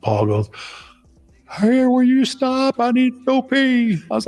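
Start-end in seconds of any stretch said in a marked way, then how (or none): 1.5-4.0 very high, slow mock plea; desperate passenger. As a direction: stretch it out as an imitated man bursting to pee.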